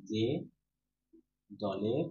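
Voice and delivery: long pause after each word; single words, widely spaced; word-by-word dictation. Shown as a demonstration. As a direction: dictate slowly for note-takers. Dictating a definition to students.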